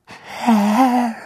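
high, strained servant's whimper; choking whimper. A narrator's reading. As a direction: choke the word out, cowering. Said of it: "würgte er hervor".

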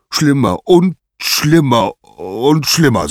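slow, high, loud lament; drawn-out, pained outcry; mounting anguish. A narrator's reading. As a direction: heave each repetition out as the pain grows. Zeus describes worsening headache.

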